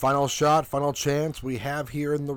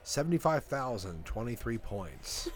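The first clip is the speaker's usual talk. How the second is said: game show host; 5 semitones lower, 4 dB quieter than usual. Acted.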